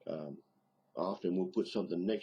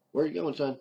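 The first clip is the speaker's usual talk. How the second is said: raised, higher-pitched parent's question; parent calling out; 9 semitones higher, 6 dB louder than usual. Acted. Parent asks five-year-old heading out to eat, calling out. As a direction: call it out to the departing kid. Parent calls to departing child.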